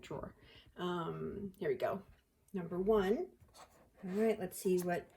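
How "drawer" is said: drawer repeated in a New York accent. Shown as a demonstration. Owning up to her accent, New York accent. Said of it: "that's my New York accent".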